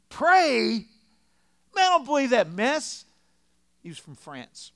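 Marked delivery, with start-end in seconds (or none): 0.1-3.0 high, booming outraged refusal; scornful old man. Acted. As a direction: holler it like a deaf, dismissive old man. Old man loudly rejects prayer offer.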